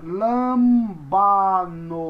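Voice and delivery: "lambano" loud and very slow; pronunciation demo. Shown as a demonstration. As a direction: stretch all three syllables of "lambano". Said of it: "A próxima palavra".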